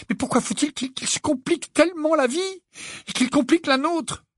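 very high-pitched, upset lament; distressed outburst. A narrator's reading. Hermione lamenting how he complicates life, distressed. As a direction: plead in exasperation, voice right up high.

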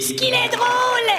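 delighted. Acted. Host gushes over guest.

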